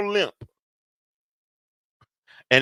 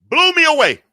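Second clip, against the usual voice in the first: high, loud burst of amazement; a preacher's amazed cry; loud exclaim; 13 semitones higher, 8 dB louder than usual. Acted. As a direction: shout it out in wonder.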